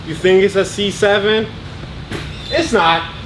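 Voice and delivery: ordinary talk, nothing marked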